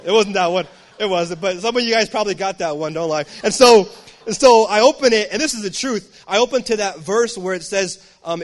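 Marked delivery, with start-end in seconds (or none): none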